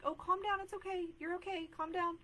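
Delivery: put-on high, loud sing-song hushing of a crying child; hammed-up high soothing calm down; mock soothing parent. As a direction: play the parent trying to shush a bawling kid.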